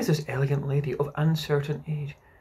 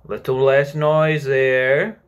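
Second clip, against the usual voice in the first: calling out; 9 dB louder, much slower than usual. A narrator's reading. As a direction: call across a noisy room, slowly. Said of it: "Peter called out".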